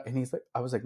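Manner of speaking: ordinary talk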